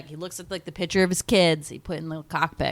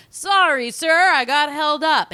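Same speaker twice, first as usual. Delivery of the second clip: high mock pilot; 9 semitones higher, 5 dB louder, slower than usual. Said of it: "Rady Queen comes in".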